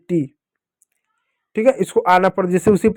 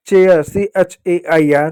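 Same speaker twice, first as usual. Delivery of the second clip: slow spelling; slower than usual. Shown as a demonstration. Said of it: "मैं लिख दूँ".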